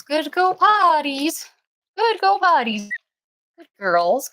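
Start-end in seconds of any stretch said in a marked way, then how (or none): 0.0-2.9 squeaky sing-song praise for the puppies, much higher; high puppy praise voice. Real, not acted. Praising puppies after potty.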